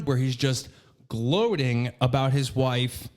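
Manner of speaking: ordinary talk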